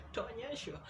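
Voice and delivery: a real laugh through the word Utaonyeshwa; laughing as she says Utaonyeshwa again; laughing repeat. Real, not acted. Repeating the warning to the viewers through laughter.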